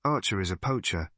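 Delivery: plain reading voice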